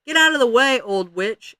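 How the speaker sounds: loud, high shove-aside shout; yelled order. A narrator's reading. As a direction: shout it at someone blocking your path. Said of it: "yelled at Ramona".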